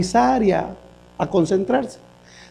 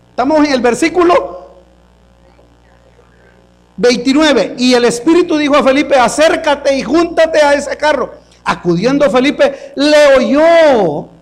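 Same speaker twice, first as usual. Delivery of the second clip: higher, louder sermon voice pressing the congregation; full-force preaching; 6 semitones higher, 3 dB louder than usual. Acted. Pastor urging the congregation to check their Bibles, preaching loud. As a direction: drive the question home.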